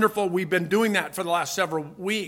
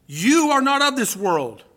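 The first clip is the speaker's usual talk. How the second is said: emphatic declaration; 6 semitones higher, 5 dB louder than usual. Acted. Preacher drives home the point.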